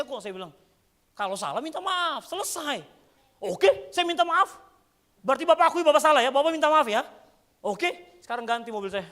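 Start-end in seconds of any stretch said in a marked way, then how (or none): 1.1-2.8 firm lecturing. Retold or imitated. Preacher lectures the other driver. 3.4-9.0 loud, high, heated mock driver; angry demand. Angry driver shouting at the pastor to replace his car.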